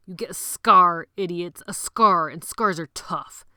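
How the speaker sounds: loud, slow, hammered-out scorn; forceful shout. Acted. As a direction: pound each word home. Leader lecturing the boys hotly.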